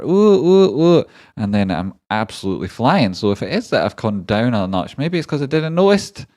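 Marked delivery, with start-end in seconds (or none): 0.0-1.1 high, loud rising "ooh, ooh, ooh"; excited oohs. Acted. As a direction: whoop like someone buzzing on coffee. Acts out coffee-fuelled excitement.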